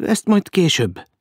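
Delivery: high-pitched, drawn-out uneasy protest; nervous objection. A narrator's reading. Maud evades the prince's question, nervous. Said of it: "ellenkezett idegesen Mód".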